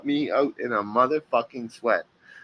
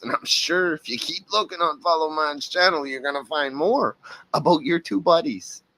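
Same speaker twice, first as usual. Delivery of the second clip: ordinary talk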